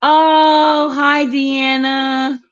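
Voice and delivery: high, drawn-out happy hello; delighted greeting. Real, not acted. Welcoming a viewer who just joined with a high delighted hello. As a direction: sing out the hello to a friend arriving.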